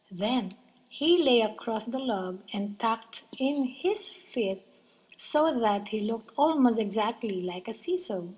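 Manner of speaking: plain reading voice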